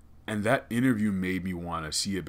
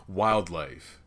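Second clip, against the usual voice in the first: normal talking voice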